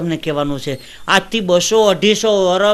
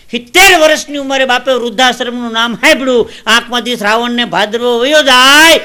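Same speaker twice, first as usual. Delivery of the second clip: raised, loud dramatic narration; impassioned storytelling; 5 semitones higher, 8 dB louder than usual. Acted. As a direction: declaim the tragic turn at full voice. Dramatic turn in the tale. (ઊંચા અવાજે ભાવુક કથાકથન)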